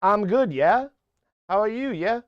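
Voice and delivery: a lightly put-on South African accent; South African accent attempt. Streamer tries a South African accent for a viewer from Cape Town. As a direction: another go at a South African lilt. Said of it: "I'm gonna try to sound like South African".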